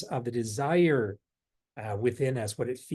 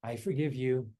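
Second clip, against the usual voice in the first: soft mantra; 5 semitones lower, 8 dB quieter than usual. Shown as a demonstration. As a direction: breathe the phrase out gently. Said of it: "to just integrate without thinking".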